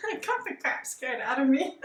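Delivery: real laugh bubbling round the words; nervous laughing. Real, not acted. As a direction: laugh it off after a fright. Laughing right after being startled.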